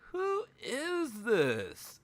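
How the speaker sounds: high, drawn-out incredulous question; slow, high-pitched bewilderment; baffled disbelief. Retold or imitated. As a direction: stretch each word in amused disbelief. Retold baffled reaction to the voice.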